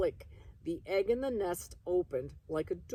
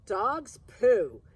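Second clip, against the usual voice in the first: louder, scornful pooh; scornful dismissal; 5 semitones higher, 11 dB louder than usual. Acted. Ada scoffing at Egan about dogs, louder and scornful.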